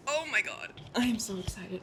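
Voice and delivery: very soft, awed hush; barely audible thrill; excited whisper. Real, not acted. Overwhelmed by excitement at books.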